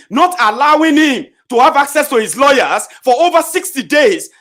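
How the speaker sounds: loud, high-pitched outburst; climbing indignant yell; outraged shout. Real, not acted. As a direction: shout the injustice at them. Outraged rant about detention.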